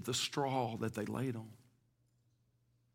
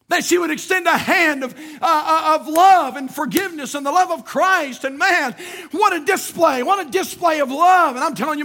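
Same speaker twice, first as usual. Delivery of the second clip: loud high impassioned sermon; full-force preaching; 13 semitones higher, 10 dB louder than usual. Acted. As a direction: preach it out to the back row.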